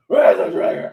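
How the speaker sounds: hammed-up growled demand; put-on vigilante growl. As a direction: bark it in a throaty hero rasp. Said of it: "why are you talking like that?".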